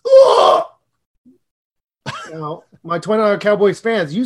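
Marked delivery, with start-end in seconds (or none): 0.1-0.6 high loud hurt ugh; pained groan. Acted. Host playing a wounded Cowboys fan, loud pained ugh.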